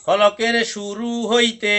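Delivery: very slow, drawn-out teacher recitation. Shown as a demonstration. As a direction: stretch each word out for the class to echo. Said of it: "পড়ুন।".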